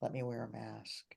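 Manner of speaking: low, subdued, held-back delivery; restrained professional mask. Retold or imitated. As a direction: imitate someone keeping all feeling in, softly and low.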